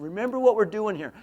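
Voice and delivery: forceful imitation of a stern coach; gruff coach. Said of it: "with a gruff voice".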